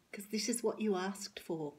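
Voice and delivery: ordinary talk, nothing marked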